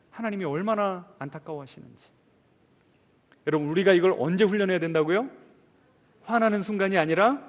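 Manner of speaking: normal talking voice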